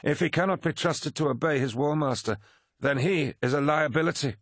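high, forceful captain's voice; hard-line Abaddon. Abaddon urges Horus to destroy Angron, forceful. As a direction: condemn him without hesitation.

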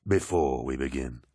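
low, smooth and commanding; commanding warrior lord. Acted. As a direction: speak like a genial, mighty lord. Narrator voices Horus.

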